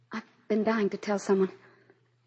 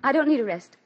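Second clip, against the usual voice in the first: sharp raised protest; indignant refusal; 6 semitones higher, 4 dB louder than usual. Acted. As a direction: push back hard at the doctor.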